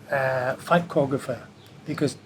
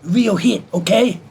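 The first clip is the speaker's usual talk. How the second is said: high, loud instruction for everybody to hear; imitated pointed order; 5 semitones higher, 7 dB louder, slower than usual. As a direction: imitate a master making a public point. Master's pointed instruction, said for all.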